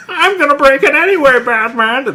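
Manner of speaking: high, fast, laughing villain voice; Joker voice. Retold or imitated. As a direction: cackle it like the Joker. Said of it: "that's the Joker".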